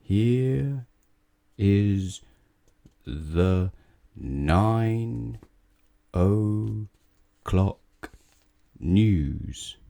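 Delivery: very slow newsreader. Acted. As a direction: read the news at a crawl. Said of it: "It took him until midnight to read it".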